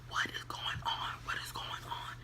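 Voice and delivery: whispered urgent questioning; hushed, voiceless retold questions; imitated whisper. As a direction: whisper it like someone asking in the background. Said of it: "All that fucking whispering and shit".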